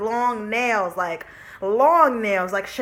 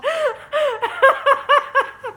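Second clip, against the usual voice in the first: loud high laugh; 13 semitones higher, 5 dB louder than usual. Real, not acted. Laughing at memory.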